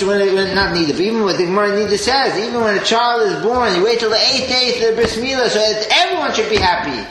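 usual speaking voice

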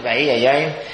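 ordinary talk

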